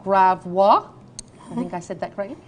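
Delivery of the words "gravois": Gravois said in a French accent, slower. Shown as a demonstration. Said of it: "my most beautiful French accent".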